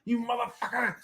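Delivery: high-pitched indignant tirade; hammed-up squeaky fury, speeding up; mock raging child. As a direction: imitate a super pissed kid ranting. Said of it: "She's, like, raging".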